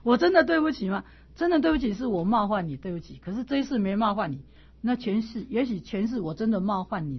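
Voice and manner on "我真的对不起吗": higher, louder incredulous self-question; doubting inner question. Retold or imitated. Asking herself while apologizing, incredulous. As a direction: ask yourself in disbelief. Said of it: "心里在想".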